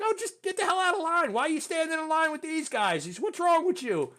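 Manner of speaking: high-pitched, loud mock exasperation; joking scolding. Sergeant pulling graduate out of line, funny exasperated scolding. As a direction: imitate a sergeant teasing through his yelling. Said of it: "he was, like, funny about it".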